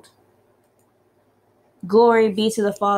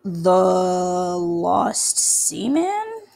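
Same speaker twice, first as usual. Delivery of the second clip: very slow, drawn-out answer; hesitant guess; much slower than usual. Acted. Player guessing the priest's riddle, very slow. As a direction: stretch it out, unsure.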